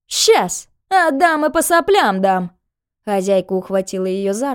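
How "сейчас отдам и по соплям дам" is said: loud, high-pitched sneering threat; angry threat. A narrator's reading. Landlady threatening the girl who wants her passport, angry. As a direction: lash out with raised pitch and volume.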